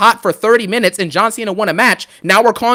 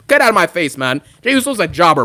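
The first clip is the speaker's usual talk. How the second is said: ordinary talk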